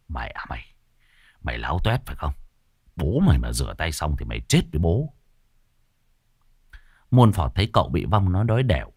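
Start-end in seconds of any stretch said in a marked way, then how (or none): 0.1-5.1 low, whispered menace; hissed threat. Acted. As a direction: threaten under the breath. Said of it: "Khuôn mặt đỏ bừng bừng". (gằn giọng đe dọa)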